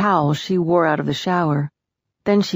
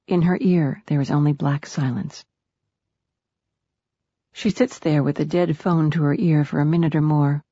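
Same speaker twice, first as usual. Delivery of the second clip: ordinary delivery, nothing marked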